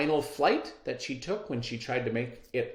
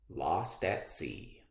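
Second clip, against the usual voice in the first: dramatic title; 9 semitones lower, 8 dB quieter, much slower than usual. Acted. Closing dramatic title read.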